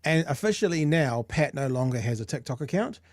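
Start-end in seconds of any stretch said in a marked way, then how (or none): none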